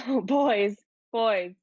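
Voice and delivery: giggling eagerly; laughing. Acted. Venla answering her friends with an eager giggle. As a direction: laugh it out with delight.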